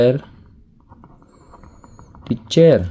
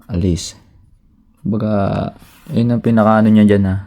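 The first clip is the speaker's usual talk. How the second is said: normal talking voice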